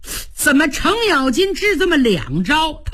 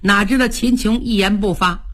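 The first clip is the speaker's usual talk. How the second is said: ordinary delivery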